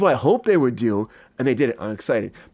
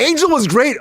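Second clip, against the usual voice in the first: hyped announcement; 9 semitones higher, 5 dB louder than usual. Acted. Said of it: "Oh, wait, Kato".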